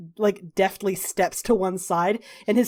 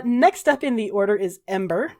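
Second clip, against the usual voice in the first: ordinary delivery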